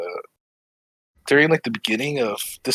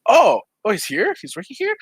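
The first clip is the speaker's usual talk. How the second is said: retold surprise; 11 semitones higher than usual. Learning the boyfriend works here, acted-out surprise. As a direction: act out being caught off guard, pitched up.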